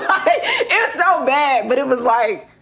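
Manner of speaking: high, slow words squeezed out through laughter; barely getting it out while cracking up; laughing. Real, not acted. Telling her audience about her ex's lie, helplessly laughing. Can't stop laughing at memory.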